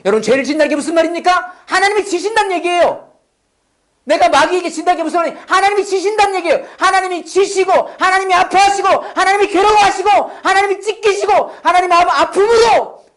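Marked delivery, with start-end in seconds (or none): 1.7-13.0 high, driving sermon climax; impassioned preaching. Real, not acted. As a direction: hammer each phrase with rising fervour. (높은 음으로 몰아치는 열띤 설교)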